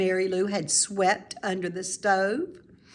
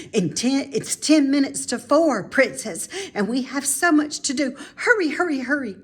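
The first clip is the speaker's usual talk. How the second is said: higher, louder hurried calls; urgent hurry; 6 semitones higher, 4 dB louder than usual. Acted. Mrs Piggle Wiggle hurrying the princess before the clock, excited. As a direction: rush her along. Jumps up, clock near four.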